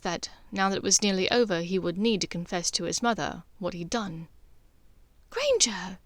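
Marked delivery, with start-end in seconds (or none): none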